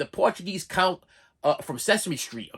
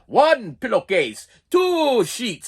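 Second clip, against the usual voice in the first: slow, louder mock counting; counting nurse; 6 semitones higher, 6 dB louder, much slower than usual. Lightly voiced nurse counting sheets at dawn, slow and louder. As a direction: spell out each item slowly.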